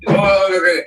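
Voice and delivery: mock the boss bowled over; impressed boss. Owner hearing a great cold reading, high amazed ah ah. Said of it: "He says".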